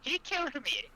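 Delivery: very high, put-on character voice. Player's character justifies the kill to Stinksack, high put-on voice. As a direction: answer back in a high, dainty voice.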